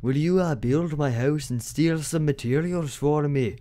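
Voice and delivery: a bad Shrek impression, pushed harder; self-mocking fake Scottish brogue; Shrek's Scottish accent. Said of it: "that's a horrible accent".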